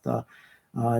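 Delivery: usual speaking voice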